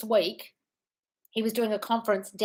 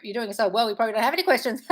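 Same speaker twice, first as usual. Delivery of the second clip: ordinary talk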